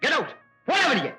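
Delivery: angry outburst in bursts; furious. Acted. Father ordering his defiant son out of the house, furious. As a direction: throw him out with each phrase.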